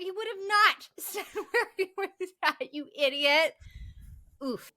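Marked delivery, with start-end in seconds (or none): none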